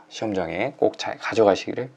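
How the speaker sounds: normal talking voice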